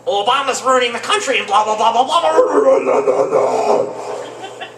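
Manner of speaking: loud high ranting babble fading to unvoiced; hammed-up angry tirade; mock ranting. Comic old lady ranting about Obama, loud. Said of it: "she just started".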